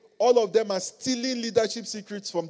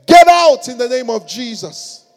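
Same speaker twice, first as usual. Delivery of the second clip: authoritative command; 3 semitones higher, 14 dB louder than usual. Shown as a demonstration. Champion commanding a demon to leave, loud authority. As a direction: bellow it once with full authority. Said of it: "you get there and say".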